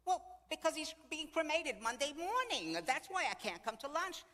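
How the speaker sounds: high, loud, insistent explanation; exasperated retort. Retold or imitated. Widow spelling out cremation excuse to friend, exasperated. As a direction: imitate a widow losing patience. June defends her excuse.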